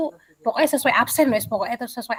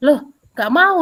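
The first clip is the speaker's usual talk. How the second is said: put-on sharp, higher, louder refusal from a stubborn boy; retold snapping refusal; 5 semitones higher, 7 dB louder, slower than usual. Defiant pupil refusing to partner with a girl, snapping. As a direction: throw it back at the teacher like a defiant kid. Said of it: "Pokoknya dia tuh bentak saya".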